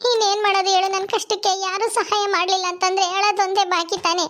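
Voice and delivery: high weepy neighbour voice; tearful complaining woman. Acted. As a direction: complain through tears, feeling refused.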